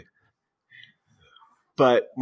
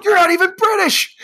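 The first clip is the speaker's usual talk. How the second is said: much higher, louder scandalised shriek; mock gasp of outrage; 7 dB louder than usual. Friend exposes the fake, shrill mock outrage. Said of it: "He's like".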